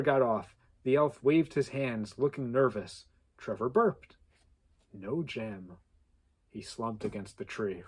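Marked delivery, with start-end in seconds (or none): none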